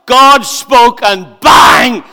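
slow, loud, the word bang thrown out; bang shout. Real, not acted. Preacher to congregation on the Big Bang, shouting. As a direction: let the bang explode. Dramatic creation moment.

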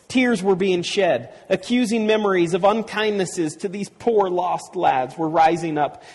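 high-pitched, rousing storytelling; exultant story narration. A narrator's reading. Preacher reads of the boys feeling like heroes, exultant narration. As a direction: narrate it as a gorgeous triumph.

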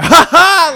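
loud, high mock laugh; forced fake laugh. Boyfriend faking laughter at an unfunny movie, loud forced laugh. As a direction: belt out a phony "ha ha".

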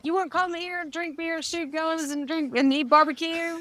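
high, eager mock Texan invitation; a hammed-up friendly Texan calling you in; mock Texan welcome. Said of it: "They're like".

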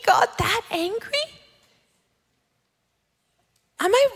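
high, small voice of dawning dismay; dismayed realization. Acted. Woman asking God about her own anger, dismayed pleading. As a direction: plead upward in mock disbelief. Acts dismay at God's correction.

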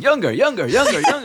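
squeaky, laughing genie chant; gleeful high-pitched chant. Acted. DM voices Genie spanking Bidet.

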